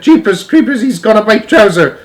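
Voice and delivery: high, loud comic alarm; startled shouting. A narrator's reading. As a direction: shout in a fluster to your mates. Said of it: "the cook shouted".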